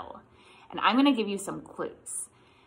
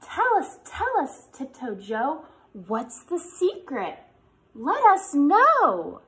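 sing-song read-aloud voice, higher; reading to children; 5 semitones higher, 5 dB louder than usual. Acted. Teacher reads the picture book refrain to kids, sing-song.

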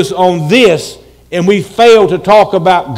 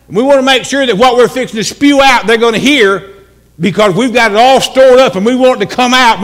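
ordinary talk, nothing marked

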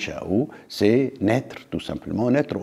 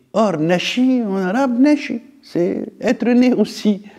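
much higher, eager pitch on the word nashi; excited discovery; 11 semitones higher than usual. Real, not acted. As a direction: lift the voice high, delighted by the coincidence. Enthusiastic discovery of shared word.

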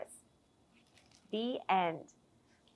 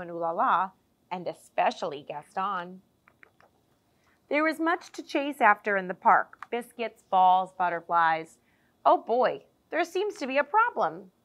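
ordinary delivery, nothing marked

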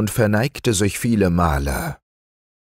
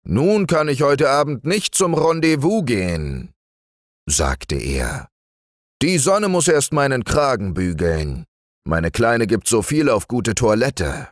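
raised, fussy bear voice; Hieronymus the bear; 7 semitones higher than usual. Acted. Hieronymus stuck at the knothole, fussing to Basilius. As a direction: complain the hole is too narrow.

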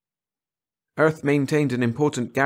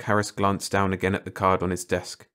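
usual speaking voice

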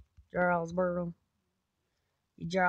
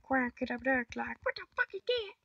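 squeaky mock shock; 8 semitones higher than usual. Repeating the squeak bit.

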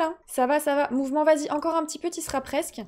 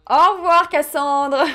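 loud farewell; 3 semitones higher, 9 dB louder, slower than usual. Acted. Loud goodbye.

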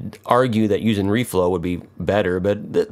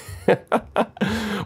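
amused laugh; 13 semitones higher than usual. Real, not acted. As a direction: chuckle at your own blunder. Amused at missing the money shot.

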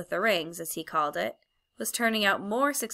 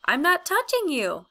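protesting; 10 semitones higher, 5 dB louder than usual. A narrator's reading. Diggory protests in story.

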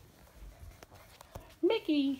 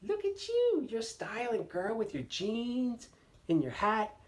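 admiring; 10 semitones higher than usual. Real, not acted. Praising toddler in her hat.

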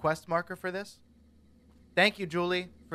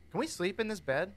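usual speaking voice

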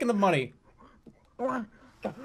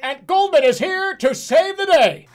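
loud, higher, slow hero announcement; heroic announcer; 9 semitones higher, 8 dB louder, slower than usual. Acted. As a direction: announce it like a superhero.

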